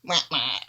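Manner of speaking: low, descending "wah, wah"; mock fail-horn noise; sad trombone imitation. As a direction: mimic a sad trombone for a failed joke. Mock sound after a weak punchline.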